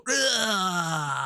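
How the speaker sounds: long, high drawn-out "raaah"; mock slow-motion yell. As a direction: imitate an action hero yelling in slow motion. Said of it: "slow motion, go".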